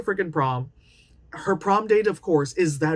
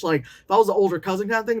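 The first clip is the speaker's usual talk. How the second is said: normal talking voice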